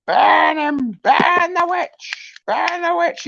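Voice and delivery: high, slow hammed-up mob chant; rally the crowd, hammy and high; angry mob leader. Mock mob chanting at viewer.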